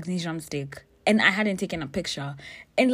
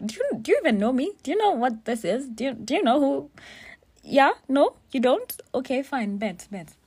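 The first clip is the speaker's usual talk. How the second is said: higher, louder, fast disbelieving questions; incredulous confrontation; 8 semitones higher, 6 dB louder than usual. Retold or imitated. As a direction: fire questions at strangers in disbelief. Retells confronting strangers at party.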